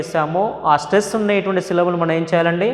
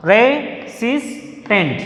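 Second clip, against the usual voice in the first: Resistant drawn out slowly, SIS lifted; slow teacher demo of re-SIS-tant; stress on SIS; 2 semitones higher, much slower than usual. Shown as a demonstration. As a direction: stretch Resistant and hit its middle. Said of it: "our stress is on the second syllable".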